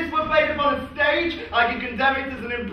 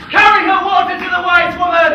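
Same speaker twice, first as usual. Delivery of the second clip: loud call, pitched up; mock urgent shout; 4 semitones higher, 9 dB louder than usual. Fabian shouts to fetch help for the madman. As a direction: call it out as if raising the alarm.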